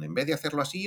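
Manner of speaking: voz aguda de Ken Brockman, tal cual se hace; voz de Ken Brockman habitual. Shown as a demonstration. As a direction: muestra el Ken Brockman de siempre, ya agudo. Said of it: "lo haría un poquito más agudo". (voz de personaje aguda, la versión normal)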